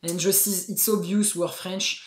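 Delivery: English spoken with French sounds; unmistakably French-accented English; thick French accent. Shown as a demonstration. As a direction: show off an obvious French accent. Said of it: "quand on parle comme ça".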